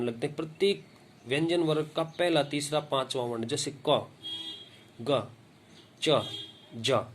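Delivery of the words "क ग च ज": क, ग, च, ज each with little breath; unaspirated consonants. Shown as a demonstration. Teacher demonstrating alpapran, क, ग, च, ज said with little air. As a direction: sound out क, ग, च, ज separately, no puff of air. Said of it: "थोड़ी वायु लगती है".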